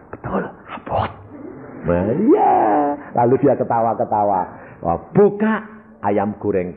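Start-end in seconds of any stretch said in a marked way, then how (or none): 0.1-1.1 hushed whisper, barely voiced; whispered amazement. Wife opening the fried chicken, whispering in amazement. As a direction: breathe it out in a stunned whisper. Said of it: "dia bilang".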